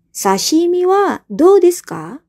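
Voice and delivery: a teacher acting out an offer; modelled offer. Shown as a demonstration. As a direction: offer it up high and slow. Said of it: "Kemudian untuk".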